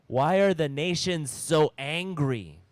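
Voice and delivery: loud, indignant reading of the verse; mock angry reading. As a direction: read it like the nations are shouting. Reading a verse about angry nations.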